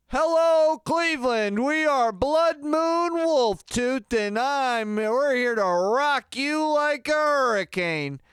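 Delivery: very high, loud, slow hype shout; rock frontman opener. Shown as a demonstration. Singer starting a show for comparison, over-the-top rock announcer yell. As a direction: belt out the band introduction, holding the vowels. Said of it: "it just wouldn't sound as entertaining".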